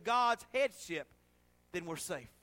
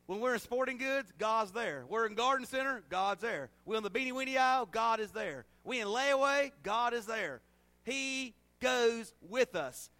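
rhythmic preaching; 4 semitones higher, 4 dB louder than usual. Acted. Rhythmic preaching call and response.

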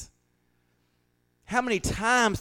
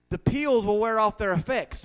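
normal talking voice

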